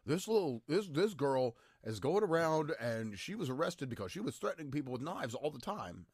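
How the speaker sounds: high, loud mock-outraged voice; put-on high-pitched accusing voice; mock accusers. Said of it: "large group of people that's like".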